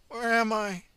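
high, slow, confused cry; dazed ambulance officer. A narrator's reading. The ambulance officer waking up confused, high and slow. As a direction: blurt it out lost and confused.

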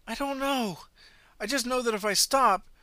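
high, loud frantic cry; panicked runner. Acted. Runner panics explaining to the driver, frantic. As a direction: blurt it out in terror.

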